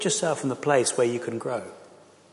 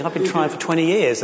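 exasperated thought; 5 semitones higher, 6 dB louder than usual. Retold or imitated. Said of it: "And you're thinking".

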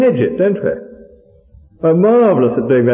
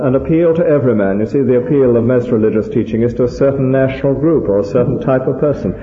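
ordinary talk, nothing marked

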